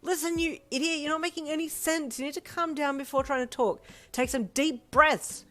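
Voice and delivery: high, forceful scolding; yelled into receiver. A narrator's reading. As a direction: yell orders to calm down. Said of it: "her sister yelled into the receiver".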